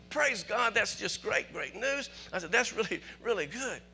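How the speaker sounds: high-pitched, delighted praise; excited phone reply, acted out; retold rejoicing. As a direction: relive the joy of hearing good news. Acts own joyful reply on phone.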